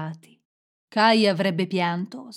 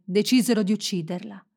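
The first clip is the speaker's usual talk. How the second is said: usual speaking voice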